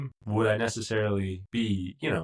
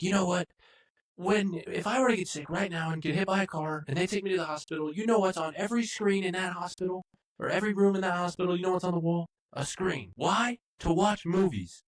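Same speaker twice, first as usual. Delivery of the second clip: mock indignant rant; 10 semitones higher than usual. Imitating Matt's angry rant.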